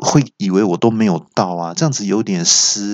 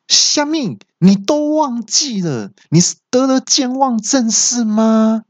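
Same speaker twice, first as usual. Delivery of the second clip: high-pitched, drawn-out disbelief; hammed-up, high, slow incredulity; incredulous outburst; 14 semitones higher, slower than usual. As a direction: exclaim in exaggerated shock, stretching it out. Acted outburst at forgetful navigator.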